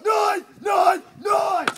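hammered-out yelled count; shouted count. Acted. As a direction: bark the numbers like a drill sergeant. Drill-sergeant count shouted.

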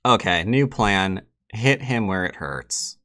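ordinary delivery

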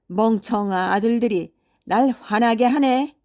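put-on outraged old father bellowing his grievance; father yelling angrily. A narrator's reading. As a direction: lament the household's ruin at full voice. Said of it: "고함을 질러댔습니다".

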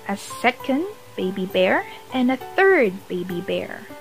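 ordinary delivery

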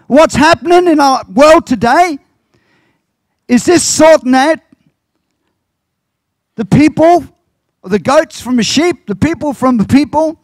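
high, loud fiery preaching; preaching at full force. Acted. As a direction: lift the congregation with Paul's promise. Preaching climax on the virus test.

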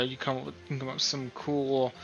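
usual speaking voice

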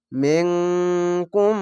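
slow drawn-out Quran recitation of মিনকুম; Quranic recitation. Shown as a demonstration. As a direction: chant মিনকুম with long held vowels. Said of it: "এক আলিফ টেনে পড়বেন".

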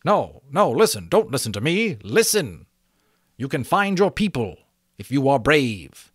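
butterfly breaking from his songs to warn her; strange voice. A narrator's reading. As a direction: suddenly speak oddly and urgently. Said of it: "said in a strange voice".

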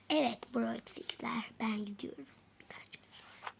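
hushed aside to the viewers; whisper. Real, not acted. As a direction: let the audience in on a secret.

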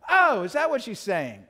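loud, high burst of realization; male voice repeating. Retold or imitated. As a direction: act a husband finally hearing his wife. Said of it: "say it in my male voice".

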